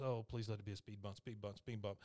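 soft, fast, hushed praying; nervous pleading. Retold or imitated. Bobby hoping he only hit a speed bump, hushed and quick. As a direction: mutter a quick little prayer under the breath. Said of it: "And he goes".

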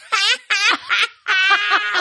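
high cackling laugh; cackle. Retold or imitated. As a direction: imitate her cackle. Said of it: "how does she laugh".

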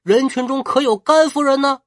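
high, loud call across a crowd. Acted. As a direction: shout out searching for someone. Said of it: "朝前面大喊说".

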